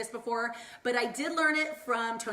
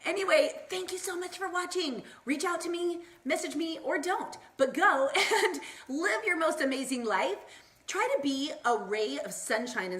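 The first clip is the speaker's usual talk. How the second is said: higher, bright sign-off breaking into a laugh; bubbly sign-off; 6 semitones higher than usual. Real, not acted. Signing off a Facebook Live to her viewers, giddy and higher. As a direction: send them off with giddy cheer.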